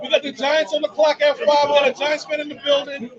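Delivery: loud, hyped shout-out; hyped announcing. Real, not acted. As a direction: pump up the crowd. Hyping the Giants pick on air.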